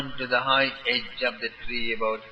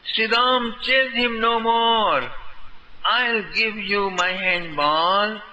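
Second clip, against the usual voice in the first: higher, louder pleading call; said loudly; 6 semitones higher than usual. A narrator's reading. Bhadra begging Sri Dama to stop the chase, loud. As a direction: beg him to stop, calling out. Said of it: "Bhadra said loudly".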